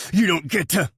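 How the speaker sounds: high, loud warning; furious. A narrator's reading. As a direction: bite it out.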